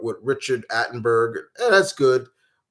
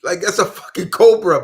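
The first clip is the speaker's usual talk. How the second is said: ordinary delivery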